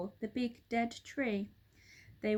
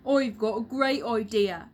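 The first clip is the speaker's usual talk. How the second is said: louder, excited announcement; excited cry; 8 dB louder than usual. A narrator's reading. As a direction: burst out with the idea. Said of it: "he cried".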